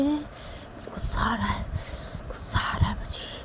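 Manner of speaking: slow, angry whisper; seething whisper. Acted. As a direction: whisper through held-in anger. बेटा दबे गुस्से में बोलता है. (गुस्से भरी धीमी फुसफुसाहट)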